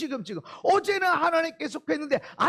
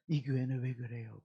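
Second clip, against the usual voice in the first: sly hushed question; 10 dB quieter than usual. Retold or imitated. Cult member sounding out churchgoer.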